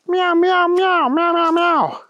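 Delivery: high, running string of meows; meowing kitty. Acted. Thunder Kitty answering the police chief, meowing. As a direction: chatter away in cat meows. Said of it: "said Thunder Kitty".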